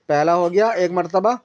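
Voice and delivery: ordinary delivery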